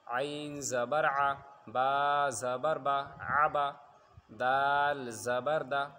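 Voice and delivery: slow, loud chant with long-held letter names; measured drill, drawn-out letters and short syllables; spelling recitation. Shown as a demonstration. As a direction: recite like a Qaida teacher, stretching each letter.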